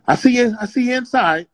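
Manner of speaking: high-pitched objection; mock protest. Acting band member answering hotel staff.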